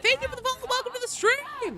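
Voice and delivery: put-on high Valley Girl lilt. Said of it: "it's the Valley Girl voice".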